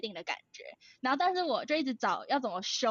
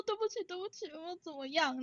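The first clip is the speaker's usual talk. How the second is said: panicked apology; 5 semitones higher, 6 dB quieter than usual. Retold or imitated. Retelling her panicked apology.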